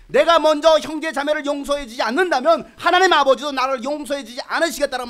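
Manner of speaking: loud, high impassioned preaching; preaching at full force. Real, not acted. Pastor rebuking the congregation, loud and high. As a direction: drive it home to the church.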